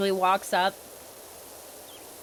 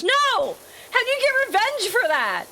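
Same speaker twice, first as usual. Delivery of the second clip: much higher, louder outburst; incredulous no; 13 semitones higher, 3 dB louder than usual. Real, not acted. Answering the host about revenge, high loud disbelief. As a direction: laugh off the very idea.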